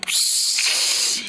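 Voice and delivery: whispered psst; stage whisper. Retold or imitated. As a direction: hiss it to get his attention. Calling Choji secretly.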